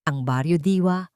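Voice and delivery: ordinary delivery, nothing marked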